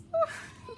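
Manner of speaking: a very high burst of laughter; giddy squealing laugh. Real, not acted. Happy dance while opening.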